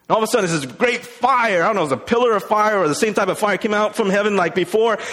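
ordinary talk, nothing marked

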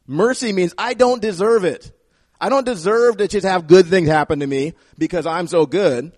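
ordinary talk, nothing marked

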